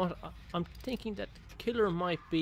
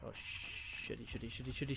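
tense whisper; 13 dB quieter than usual. Real, not acted. Hiding tense, chant under breath.

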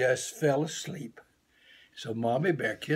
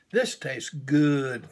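slow, louder savoring of the taste; 4 dB louder, much slower than usual. Acted. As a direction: draw every word out as if tasting it. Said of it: "thought Little Bear".

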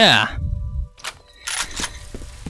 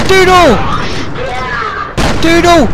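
yelling a name; 11 semitones higher than usual. Real, not acted. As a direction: bellow the name with each hit. Calling out while attacking.

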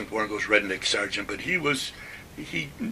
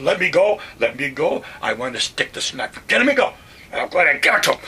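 straining shout; 3 semitones higher, 7 dB louder than usual. Retold or imitated. Hell's Kitchen guy held back from the sergeant, yelling. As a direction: fight against being held and yell.

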